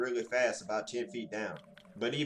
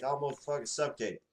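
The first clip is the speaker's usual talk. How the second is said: ordinary delivery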